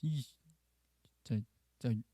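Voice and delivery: acted muttered exasperation, low and soft; Moses swallowing curses. Moses wanting to curse the people, muttering exasperatedly. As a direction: hold back a swear word under your breath. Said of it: "욕을 하고 싶었겠죠".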